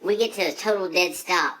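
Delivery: usual speaking voice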